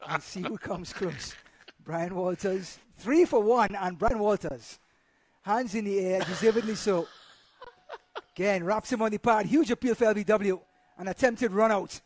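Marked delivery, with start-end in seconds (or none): 4.0-12.0 cricket commentator. Acted. Live play-by-play.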